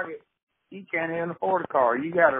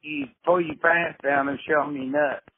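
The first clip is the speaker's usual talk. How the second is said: usual speaking voice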